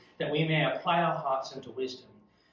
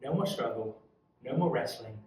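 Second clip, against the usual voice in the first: ordinary talk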